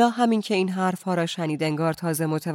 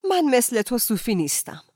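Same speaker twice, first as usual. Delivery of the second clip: louder, higher impatient outburst; impatient shout; 6 semitones higher, 5 dB louder than usual. A narrator's reading. Ella, exasperated, shouting at Aziz impatiently. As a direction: snap it out, fed up.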